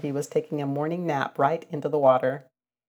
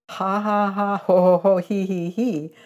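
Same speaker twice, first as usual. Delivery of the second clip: a gleeful ha ha ho ho hee hee, higher and louder; laughing; 5 semitones higher, 4 dB louder than usual. Acted. Billy Mink laughing at Reddy Fox tumbling in, gleeful laughter. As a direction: burst out laughing at him. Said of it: "laughed Billy Mink".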